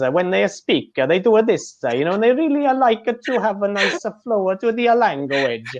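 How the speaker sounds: high, lilting Italian-style English; Italian accent. Retold or imitated. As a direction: mimic the Italian melody in English. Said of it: "try and mimic that Italian into English".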